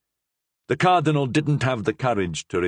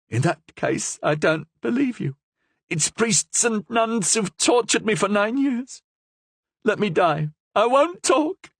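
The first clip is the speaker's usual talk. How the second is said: high, bitter prisoner voice; 13 semitones higher than usual. A narrator's reading. Prisoner refusing the priest, high and bitter.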